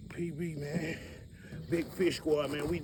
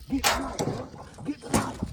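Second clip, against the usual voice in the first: excited call; 9 semitones higher, 4 dB louder than usual. Real, not acted. Excited call while landing fish.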